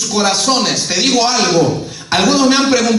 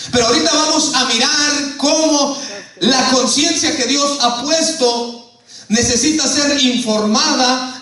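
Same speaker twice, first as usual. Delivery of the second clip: ordinary delivery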